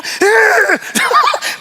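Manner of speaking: laugh. Real, not acted. Laughs about road rage.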